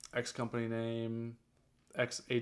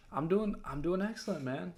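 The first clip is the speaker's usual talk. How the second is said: upbeat reply; 6 semitones higher than usual. Acted. Caller answers the greeting.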